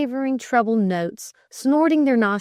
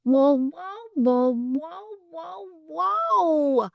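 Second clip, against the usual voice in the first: high stretched dog howls; wolfhound howl; 9 semitones higher, much slower than usual. Acted. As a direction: keep howling along to the carol.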